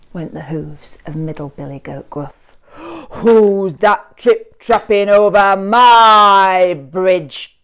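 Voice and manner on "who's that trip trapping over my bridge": loud, slow growling troll demand; grumbling troll. A narrator's reading. Troll challenging the goat on his bridge, loud slow growl. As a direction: make the whole bridge shake with it. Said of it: "grumbled the Troll".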